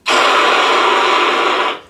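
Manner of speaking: loud hissing noise burst; imitated radio static. Imitating the noise of two stations mixed, loud static hiss. You just hear.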